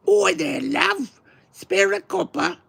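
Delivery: very high, loud squeaky cockney beggar; mock cockney accent. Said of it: "Something like that".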